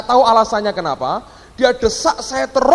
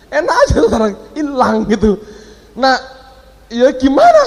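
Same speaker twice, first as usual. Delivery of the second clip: laughing; 5 semitones higher, 3 dB louder than usual. Real, not acted. Laughs at the woman's excuse.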